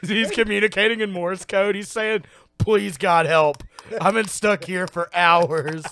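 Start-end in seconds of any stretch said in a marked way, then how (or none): 2.6-5.8 loud acted begging; loud, stretched comic wail; mock desperate plea. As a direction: plead for rescue in hammed-up despair. Voicing trapped man's plea.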